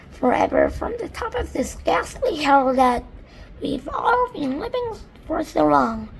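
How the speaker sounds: high, tearful voice breaking; stumble, near tears; ladybird voice. Acted. Ladybird lost for words describing the land, high tearful voice.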